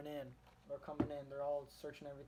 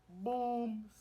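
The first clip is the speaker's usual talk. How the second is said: higher and louder shouted boom; explosion sound; 8 semitones higher, 7 dB louder than usual. Retold or imitated. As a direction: punch it out like a blast. Imitating the explosion.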